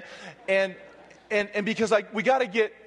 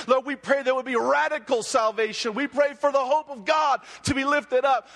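fervent prayer; 4 semitones higher than usual. Acted. Rising prayer at full force.

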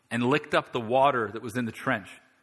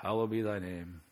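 mock distracted prayer; 4 dB quieter than usual.

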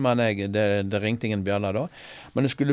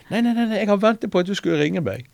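high, quick, excited nei nei nei; eager reassurance; 10 semitones higher, 4 dB louder than usual. Retold or imitated. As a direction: keep her on the line, eagerly. Eagerly telling caller he awaited her. (ivrig og lys: jeg har ventet på deg)

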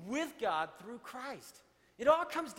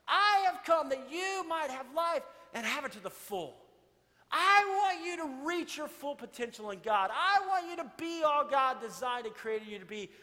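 louder, higher preaching climax; full-force preaching; 6 semitones higher, 5 dB louder than usual. Acted. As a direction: preach it hard to the congregation.

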